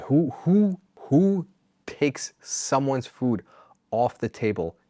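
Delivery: high, incredulous who who who; outraged. Real, not acted. Ranting about the dad stealing food, incredulous and high. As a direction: sputter in disbelief.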